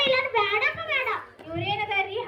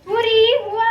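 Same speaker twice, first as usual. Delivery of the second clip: louder hawker's call, stretched at the start; street vendor cry; 7 dB louder, slower than usual. Acted. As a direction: cry out your flowers to the street. Flower seller street cry. (ಹೂ ಮಾರುವವಳ ಕೂಗು)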